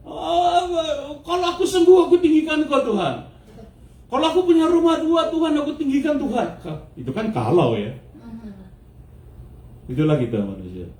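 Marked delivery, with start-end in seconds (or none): 0.1-6.5 high, overearnest pleading pitch; mock eager promising. Preacher mimicking people promising God praise, high mock pleading. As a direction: bargain with God in a raised, satirical voice. Acted human reply bargaining with God.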